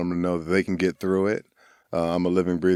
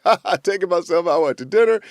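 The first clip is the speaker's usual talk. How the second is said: joking deflection; 7 semitones higher, 4 dB louder than usual. Retold or imitated.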